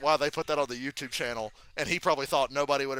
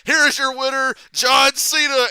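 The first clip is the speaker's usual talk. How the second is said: loud, high winner announcement; imitated arena announcer, big and raised; mock ring announcer; 9 semitones higher, 11 dB louder than usual. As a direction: boom it out like a wrestling announcer.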